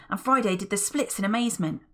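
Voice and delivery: ordinary delivery, nothing marked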